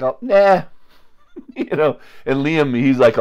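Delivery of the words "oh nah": dismissive brush-off. Retold or imitated. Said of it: "And we were just like".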